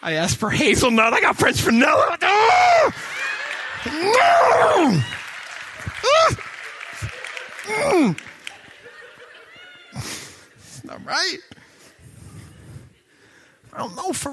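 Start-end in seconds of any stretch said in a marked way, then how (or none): 0.0-8.2 very high loud fake sobbing shouts; mock wailing. Preacher mocking a wailing fan over the wrong coffee. 9.9-11.4 fake whimper. Mock crying continues.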